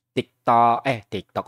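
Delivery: ordinary talk, nothing marked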